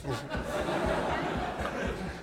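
soft breathy laugh. Real, not acted. Audience/speaker laughs after joke.

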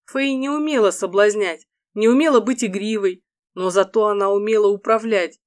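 ordinary delivery

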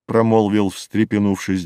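plain reading voice

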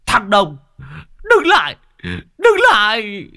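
high, loud furious yelling; furious shout. Acted. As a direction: scream after the fleeing car in rage. Lão Hói chửi đuổi theo xe. (la hét giận dữ)

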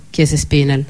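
plain reading voice

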